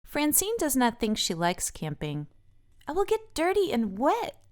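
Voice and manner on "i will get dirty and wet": put-on high, whiny girl worried about dirt; Francine's high fussy voice. A narrator's reading. Francine fretting to Olivia about camping mess, high and whiny. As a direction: pitch it up like a fussy little girl. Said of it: "she says".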